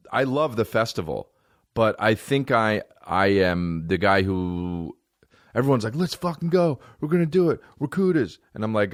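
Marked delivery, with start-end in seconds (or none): 5.9-8.4 higher-pitched imitation of pumped-up comedians; rally the gang in a raised voice; mock hype. Comedians psyching each other up for the festival, mock hype.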